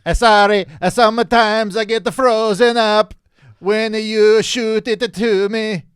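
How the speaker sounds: high, loud halting alien voice. Pretend. Answers in alien voice.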